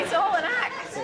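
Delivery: high-pitched teasing accusation; mock accusation. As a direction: pretend to expose a fraud.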